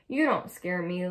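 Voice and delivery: plain reading voice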